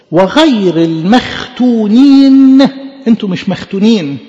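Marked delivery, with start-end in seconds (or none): 0.1-2.6 loud, drawn-out quoted rebuke; scripture declamation. Retold or imitated. Preacher quotes Stephen to the congregation, declaimed. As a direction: declaim it slowly, stretching the last word loud.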